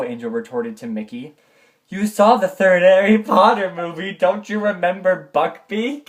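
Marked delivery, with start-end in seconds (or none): 1.9-6.1 exasperated shout. Acted. Angel scolds her brother.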